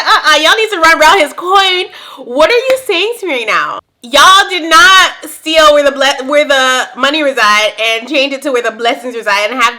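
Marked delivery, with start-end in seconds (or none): 0.0-3.8 high, loud and laughing disbelief; loud outraged retort. Retold or imitated. Reacting to the church commercial, loud laughing outrage. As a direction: call them out, laughing. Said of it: "I said".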